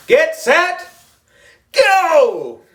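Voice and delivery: high, loud race starter's yell; yelled start call. A narrator's reading. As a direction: fire off the start like a gym teacher. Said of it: "she yelled".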